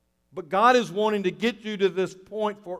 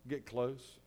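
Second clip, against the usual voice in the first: hushed repeat; 5 semitones lower, 15 dB quieter than usual. Real, not acted.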